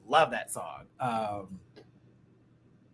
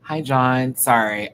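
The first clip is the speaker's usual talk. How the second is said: usual speaking voice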